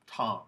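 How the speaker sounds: "tom" with a full open ah vowel; no schwa. Shown as a demonstration. Teacher reading the lone word, full-vowel "tom". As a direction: say "tom" clearly unreduced. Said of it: "no schwa at all".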